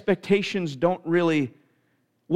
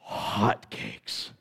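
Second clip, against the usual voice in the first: awed whisper; 9 semitones lower, 8 dB quieter than usual. Retold or imitated. Repeats the magic word in awe.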